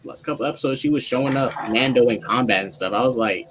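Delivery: usual speaking voice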